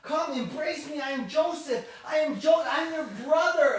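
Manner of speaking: impassioned acted outburst; shouted revelation. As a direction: cry it out across the room. Joseph cries out to his brothers.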